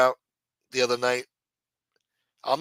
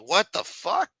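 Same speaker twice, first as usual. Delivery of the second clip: mock shock, pitched higher with a rising end; retold shock; 6 semitones higher, slower than usual. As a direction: act out your stunned reaction, voice lifting. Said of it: "I was shocked to hear that".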